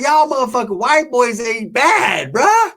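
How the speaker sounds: high, slow put-on dealer voice. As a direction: squeeze it up high, sing-song praise.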